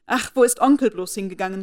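loud, worried exclamation; urgent cry. A narrator's reading. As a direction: cry out for her uncle in alarm. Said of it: "rief Elizabeth aus".

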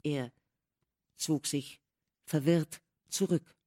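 ordinary delivery

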